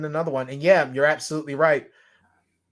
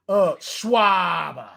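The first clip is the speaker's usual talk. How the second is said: drawn-out Boston "Schwarber", dropped R; hammed-up slow Boston accent; 3 dB louder, much slower than usual. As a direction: stretch Schwarber slowly in Boston style. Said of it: "Do a Schwarber Boston impression".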